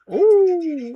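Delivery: long, high, loud "ooh"; excited ooh. Real, not acted. Watcher sees him hit with the DDT, excited ooh. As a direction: react big to a wrestling slam.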